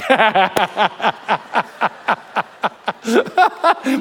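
long staccato chuckle; drawn-out run of pulsing laughter; laughing at own joke. Real, not acted. Laughing at his own pun.

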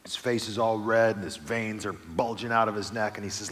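usual speaking voice

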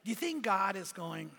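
normal talking voice

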